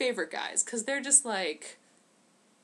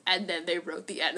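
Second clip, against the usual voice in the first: ordinary talk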